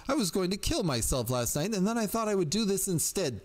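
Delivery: put-on high-pitched distraught girl's confession; pitiful bleat. A narrator's reading. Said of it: "the girl bleated pit-, pitifully".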